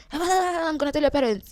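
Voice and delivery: high, drawn-out mock teacher threat; scolding threat. Teacher threatening to tell pupil's parents, scolding.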